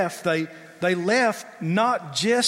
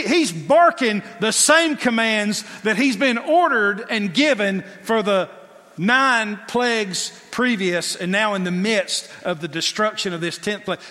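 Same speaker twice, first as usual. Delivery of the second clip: ordinary delivery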